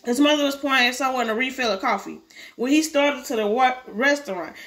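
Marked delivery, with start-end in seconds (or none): none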